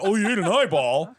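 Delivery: high, loud mock outrage; mocking imitation. Defending himself to co-hosts who judge him, mocking imitation.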